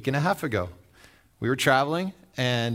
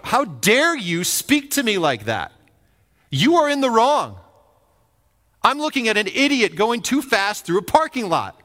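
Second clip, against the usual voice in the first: louder, higher indignant retort; 9 semitones higher, 6 dB louder than usual. Retold or imitated. Pastor imagining telling off the rude driver, rising and loud. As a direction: let the offended pride rise. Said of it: "I felt this".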